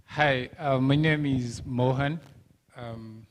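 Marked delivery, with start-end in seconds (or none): none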